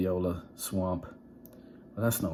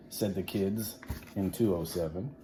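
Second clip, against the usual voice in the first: usual speaking voice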